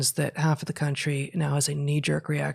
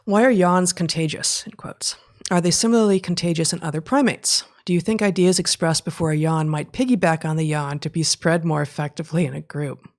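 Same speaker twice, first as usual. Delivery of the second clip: reading out a question, loud and projected; question read aloud; 2 semitones higher, 7 dB louder than usual. Real, not acted. Co-host reads out an audience question on yawning, read-aloud voice. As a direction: read the question out with firm emphasis.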